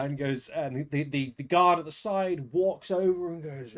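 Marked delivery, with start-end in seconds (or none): none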